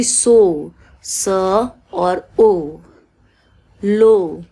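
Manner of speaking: सो split slowly into स and a long ओ; stretched long o. Shown as a demonstration. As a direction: drag सो and लो out slowly. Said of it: "ओ खिंच रहा है". (सो और लो, ओ को धीरे खींचते हुए)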